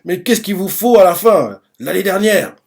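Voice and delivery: high-pitched, incredulous acted challenge; indignant rebuke. Shin cuts in, scolding soldiers.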